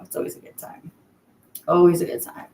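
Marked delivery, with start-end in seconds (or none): 0.0-0.9 whispered, much softer; under her breath. Real, not acted. To her livestream viewers, whispered aside. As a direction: let it slip out as a whisper.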